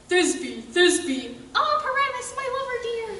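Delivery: lightly voiced tiny lady; monstrous little voice. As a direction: squeak it out a little, half-mocking. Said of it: "I'll speak in a monstrous little voice".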